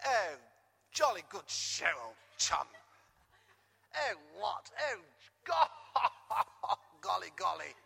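mock upper-class British exclamations with a laugh; posh British. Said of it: "We're all typically British".

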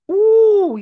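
high, loud joyful cry; excited ooh. Real, not acted. Preacher thrilled by the crowd's affirmation. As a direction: let it burst out in delight.

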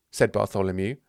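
ordinary delivery

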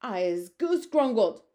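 howling captured giant, loud and slow; giant's howl. Acted. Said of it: "howled the man hunger".

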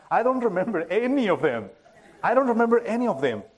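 ordinary talk, nothing marked

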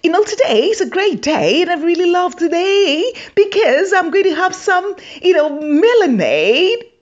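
high, prim Queen's English voice; posh English. Pretend. Comic showing Africans a polished English: high, prim posh voice. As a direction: pitch it up high and overly proper. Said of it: "you say that".

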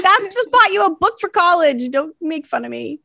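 high-pitched mom comeback, easing down; mock-indignant retort. Mom answering kids who tease her business, mock indignation.

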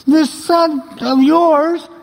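high, slow mock whine; crybaby older son. Older son disowning his brother to father, whining crybaby. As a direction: draw it out like a sulking crybaby. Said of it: "That's how it really sounded".

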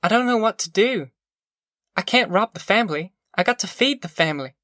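high helpless voice, easing down; mother torn over feeding everyone; troubled mother. A narrator's reading.

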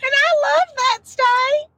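high laughing voice; laugh-crying. Real, not acted. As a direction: say it through laughter. Laughing, voice breaking.

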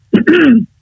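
short discreet throat clear. Shown as a demonstration. Coach shows a boardroom warm-up, discreet throat clear. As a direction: loosen the throat with a small clear. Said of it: "a clearing of the throat".

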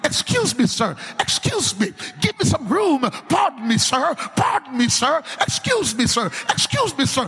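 high, pressing polite excuses; insistent man pushing through. Acted. Man of God pushing through the crowd, insistent. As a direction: keep pressing forward politely.

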